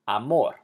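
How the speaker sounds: "Amor" corrected to a-MOR; "Amor" with a stressed ending; stress on last syllable. Shown as a demonstration. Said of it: "emphasize the last syllable".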